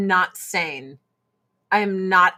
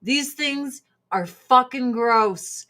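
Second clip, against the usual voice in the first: ordinary delivery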